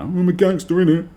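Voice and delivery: mock gangster. Mocking fake gangsters.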